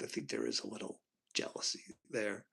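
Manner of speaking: hushed confession to the stream; secretive low whisper; whispered aside. Real, not acted. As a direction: whisper it so she can't hear. Confiding about wife's jealousy.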